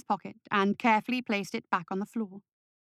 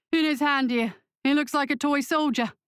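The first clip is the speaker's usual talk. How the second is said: higher-pitched guard's voice; guard character; 5 semitones higher, 3 dB louder than usual. A narrator's reading. First guard showing companion the toy, raised voice. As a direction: voice a guard pointing out a find, pitched up.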